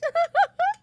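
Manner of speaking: squeaky high burst of laughter. Real, not acted. Laugh alone.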